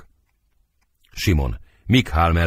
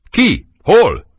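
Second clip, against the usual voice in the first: high, startled-angry one-word questions; agitated challenge; 9 semitones higher, 5 dB louder than usual. Acted. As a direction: fire out each question high, jumping up to confront. Petúr leaps up, challenging them. (ingerült, magas hangú számonkérés)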